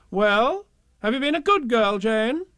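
high, sing-song rising question; high-pitched, insistent grown-up; questioning parents. A narrator's reading. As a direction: quiz a small child in a high parental coo. Parents voiced questioning the child.

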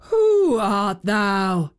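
slow, drawn-out giant question; awed giant. A narrator's reading. Giant asking the child's identity, slowly in awe. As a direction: stretch it out as a wondering giant.

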